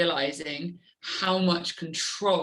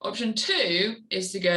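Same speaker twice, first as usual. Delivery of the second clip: normal talking voice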